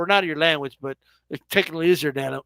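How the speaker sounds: usual speaking voice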